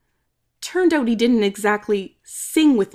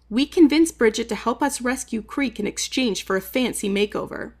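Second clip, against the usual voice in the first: usual speaking voice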